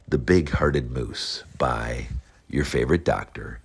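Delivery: plain reading voice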